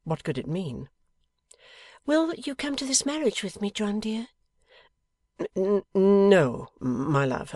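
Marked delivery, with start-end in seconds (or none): 2.1-4.3 put-on high-pitched young wife's voice; Bella, coaxing wife. A narrator's reading. Wife asks husband to come to the marriage, coaxing. 5.4-7.5 loud stuttered start, drawn-out refusal; hesitant refusal. A narrator's reading. Husband refuses his wife's invitation, hesitant and stammering.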